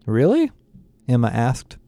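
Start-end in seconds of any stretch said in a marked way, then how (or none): 0.1-0.5 higher-pitched surprise, rising sharply; genuinely surprised. A narrator's reading. Emma hearing Paul turn on Hayes, surprised. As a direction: let the question leap up in surprise. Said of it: "genuinely surprised".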